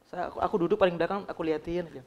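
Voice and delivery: usual speaking voice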